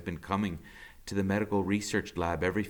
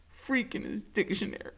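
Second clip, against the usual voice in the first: quiet, breathy laugh through grief; soft rueful laugh; 9 semitones higher, 4 dB quieter than usual. Acted. Max remembering Freak, a quiet rueful laugh. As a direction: laugh softly at the memory.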